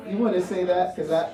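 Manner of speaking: ordinary talk, nothing marked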